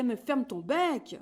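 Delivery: loud, slow exasperated yell; the farmyard yelling at the chick; shouting in chorus. A narrator's reading. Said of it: "recommencent à crier".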